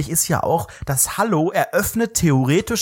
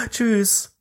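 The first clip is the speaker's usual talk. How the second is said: inhale then goodbye; 7 semitones higher than usual. Shown as a demonstration. Showing how to catch a passerby's goodbye, quick inhale then high Tschüss. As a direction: breathe in fast and slip out a bye.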